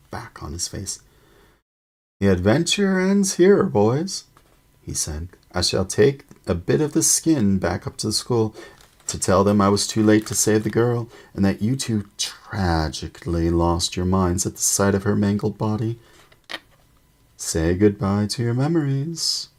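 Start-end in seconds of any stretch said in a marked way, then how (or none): 2.2-4.2 high, loud, gloating villain; triumphant smug villain voice. A narrator's reading. Villain gloats over trapped boys. 5.5-19.5 loud smug villain announcement; gloating villain. A narrator's reading. Villain gloats over trapped boys.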